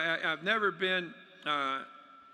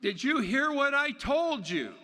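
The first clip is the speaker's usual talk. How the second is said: higher, louder, exasperated little frog; impatient frog; 6 semitones higher, 4 dB louder than usual. Pretend. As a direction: demand an answer, fed up. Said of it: "And the frog says".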